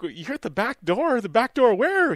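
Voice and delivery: rising, confused repeat of the back door; mock baffled question climbing high; puzzled echo. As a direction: ask it back in disbelief. Confused reply, retold.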